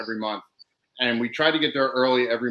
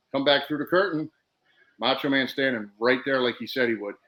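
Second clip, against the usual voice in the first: normal talking voice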